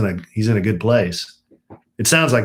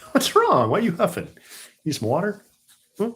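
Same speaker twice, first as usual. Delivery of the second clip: pet talk; 9 semitones higher than usual. Real, not acted. Talking to the panting dog.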